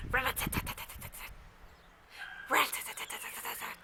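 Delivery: fast, breathy rat-a-tat; quiet rapid woodpecker drumming; woodpecker tapping. A narrator's reading. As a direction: tap it out quick like a little hammer. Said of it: "sounds like a little hammer".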